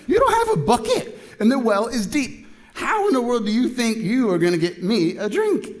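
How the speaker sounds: high, loud, hammed-up incredulity; exasperated disbelief. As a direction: challenge him at the top of your range. Acted incredulous challenge to Jesus.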